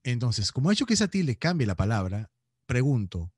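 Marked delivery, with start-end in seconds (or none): none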